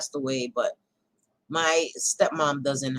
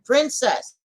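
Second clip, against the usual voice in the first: raised, higher-pitched call; calling the dog; 6 semitones higher, 4 dB louder than usual. Real, not acted. Owner calling her excited dog mid-chat, calling out. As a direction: call out sharply to a pet.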